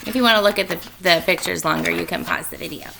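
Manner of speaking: ordinary delivery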